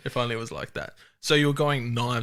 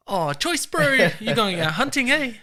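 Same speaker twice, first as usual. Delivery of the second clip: high, louder, drawn-out imitation of a Kiwi greeting; hammed-up friendly Kiwi, voice pitched right up; keen New Zealander; 11 semitones higher, 6 dB louder than usual. New Zealand local greets arriving hunters, hammed-up keen Kiwi. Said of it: "Getting into New Zealand, they're like".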